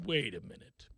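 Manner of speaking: low, hushed reenacted suspicion; dawning realisation. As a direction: drop right down as it sinks in.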